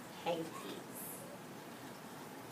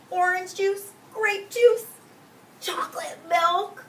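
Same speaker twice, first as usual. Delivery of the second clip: high, bright, loud food list; excited list; 8 semitones higher, 4 dB louder than usual. A narrator's reading. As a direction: savour each treat with delight.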